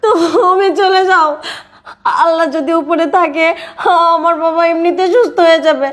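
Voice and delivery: sobbing, tearful pleading; crying. Acted. Daughter begs her father's forgiveness and sends him away, crying. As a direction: plead through sobs, drawing words out in tears. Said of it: "তুই কান্দিস না মা".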